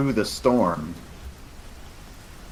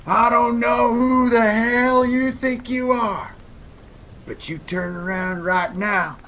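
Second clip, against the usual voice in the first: high, drawn-out holler from afar; yelling hostile stranger; 12 semitones higher than usual. Acted. Unseen stranger warns intruders in the dark, hollered threat. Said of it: "and then a voice says".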